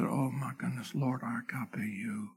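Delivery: whispered, hushed and grieving; grieved whisper. Acted. Preacher voicing grieved Peter answering Jesus, whispered. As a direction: drop to a breathy whisper as if heartbroken.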